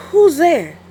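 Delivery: high, loud call into the bushes; startled call. Acted. Wolf wakes to a noise, high loud call. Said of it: "said the wolf".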